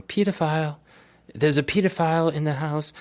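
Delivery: high put-on British tannoy announcement; imitating a British airline announcer; mock British announcer. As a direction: announce it like airline cabin crew over the speaker. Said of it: "they're, like, announcing".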